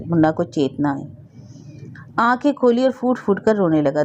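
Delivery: usual speaking voice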